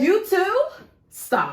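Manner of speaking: high, loud "You too?" then a firm "Stop."; delighted disbelief. Real, not acted. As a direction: squeal in happy recognition. Friend shares same shower prayer habit.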